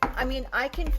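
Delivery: normal talking voice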